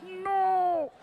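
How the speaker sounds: sudden high, horrified cry of no; panicked no. Retold or imitated. Comedian reliving his slip, panicked cry. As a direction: try to take back the words in panic. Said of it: "and you're like".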